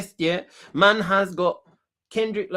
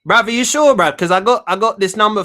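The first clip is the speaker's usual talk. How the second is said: loud phone call; 5 semitones higher, 11 dB louder than usual. Real, not acted. Prank call, raising voice.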